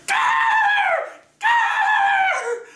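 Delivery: very high, loud panicked shriek; mom shouting. Retold or imitated. Mom yelling a warning at the TV screen, high frantic shout. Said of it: "I hear from my mother".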